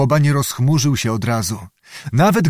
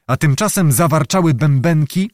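ordinary delivery, nothing marked

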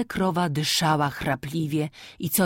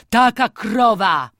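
louder, wailing repeat with TAKA stressed; anguished lament, louder on TAKA; 7 semitones higher, 9 dB louder, slower than usual. A narrator's reading. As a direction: cry it out in grief for the cow. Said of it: "wyrzekał coraz żałośniej".

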